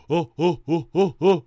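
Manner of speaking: panicked hunters. Acted. Early humans chasing the zebra, calling out loud. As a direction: chant it loud as you chase. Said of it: "And they go".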